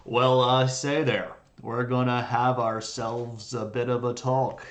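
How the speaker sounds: a rough sinus-clogged John Wayne imitation; hammed-up John Wayne cowboy voice; John Wayne impression. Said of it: "the John Wayne of the Franks".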